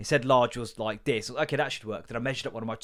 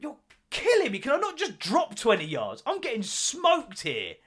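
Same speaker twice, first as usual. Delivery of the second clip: high, pleading mock complaint; a hammed-up high whine from a tired centre-back; whining centre-back; 11 semitones higher than usual. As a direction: moan like a knackered defender. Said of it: "centre-backs like".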